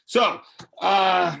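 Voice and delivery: fighting back tears; a real catch in the throat; choked up. Real, not acted. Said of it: "before I start crying".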